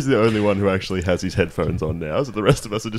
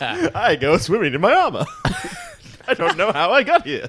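higher goofy oblivious voice; mock insane character. Suggesting an insane self-aware character, goofy high voice. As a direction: play a cheerful lunatic. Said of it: "so you're just like".

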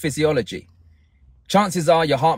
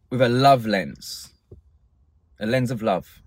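calm guided meditation; 3 semitones lower than usual. Acted. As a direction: ease them gently into calm. Guides listeners into love lens.

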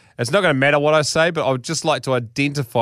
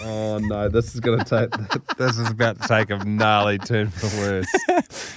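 laughing while talking; 4 semitones lower than usual. Real, not acted. Reader cracking up at story.

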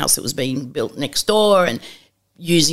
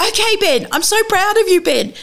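proud encouragement; 13 semitones higher than usual. Retold or imitated. Cheering on stranger on phone.

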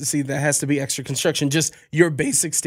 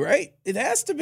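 high pleading; 11 semitones higher than usual. Real, not acted. Insisting, voice jumps high.

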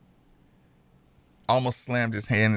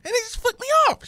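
high-pitched falsetto of a confused, flustered mom; faster than usual. Retold or imitated. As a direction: play the panicking mother, voice high and flustered. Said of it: "she's like".

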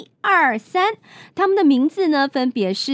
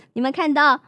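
usual speaking voice

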